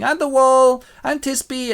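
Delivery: high, declaimed play lines; bad amateur actors. Labourers rehearsing their play, stagey. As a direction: announce the role at high pitch. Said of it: "they start rehearsing".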